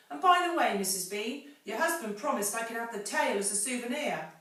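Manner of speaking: high, loud call across the cellar; big woman's call. Acted. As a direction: servant woman calling upstairs. Mabel calls out to Mrs Bean.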